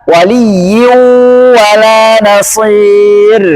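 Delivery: waliyyu wala nasir slow, nasal hum on the tanwin; nasal ghunnah reading. Shown as a demonstration.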